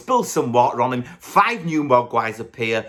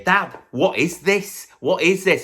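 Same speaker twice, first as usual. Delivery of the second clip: put-on higher, slow puzzled kid; higher mock puzzled son; imagined Billy; 8 semitones higher than usual. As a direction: play a baffled boy asking dad. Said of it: "Not once does he say".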